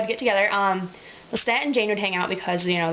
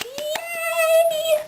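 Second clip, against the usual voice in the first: high squealed yay; a sudden squeal of fake glee; pitched way up in a cartoon cheer; mock cheer. Mock cheer about Christmas.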